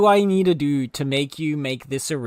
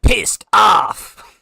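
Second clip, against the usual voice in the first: louder take of "Pissed off!", shouted; angrier pissed off; 3 semitones higher, 9 dB louder than usual. Shown as a demonstration. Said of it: "You're not pissed off enough".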